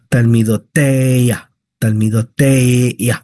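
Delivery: "talmidotea" slowly, the stress landing on the "e" of -tea; careful slow "talmidotea" with stress on the segol syllable. Shown as a demonstration. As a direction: stretch "talmidotea" and lean on the "te". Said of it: "Aquí está el énfasis o la acentuación en la segol".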